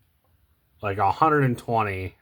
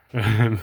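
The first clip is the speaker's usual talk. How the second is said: brief, louder laugh at own slip; short laugh; 3 semitones higher, 6 dB louder than usual. Real, not acted. Amused at own slip of tongue.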